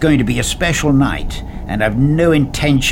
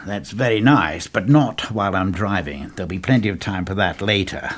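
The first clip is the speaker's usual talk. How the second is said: lower, gentler and softer; softening; 5 semitones lower, 5 dB quieter than usual. A narrator's reading. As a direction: ease off, calm him down. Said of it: "Softening a little".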